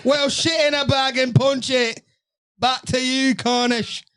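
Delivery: hammed-up British voice, pitched high; British accent. Podcast co-host tosses it back to Cornish, mock British accent. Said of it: "everything I said was in British accent".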